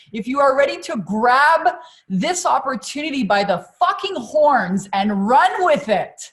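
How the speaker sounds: loud, high, excited call to the audience; rally the crowd at full energy; pumped-up webinar host, punching key words; hyped-up rallying. Real, not acted.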